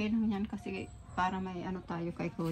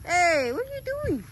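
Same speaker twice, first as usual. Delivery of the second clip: higher, louder, slower call at the naughty dog; high scolding call; 11 semitones higher, 9 dB louder than usual. Real, not acted. Calling out to the dog.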